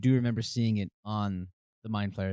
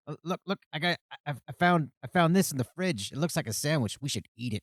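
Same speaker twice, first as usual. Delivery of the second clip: high-pitched, excitable character voice; Stennel; 6 semitones higher, 3 dB louder than usual. Acted. Game master imitating a party member showing off a find, high and eager. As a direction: stammer it out eagerly in a raised pitch. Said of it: "don't do a Stennel".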